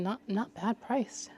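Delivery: usual speaking voice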